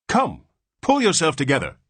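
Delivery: high, loud command. Acted. Nurse to the father, commanding. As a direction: order him briskly. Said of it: "commanded the nurse".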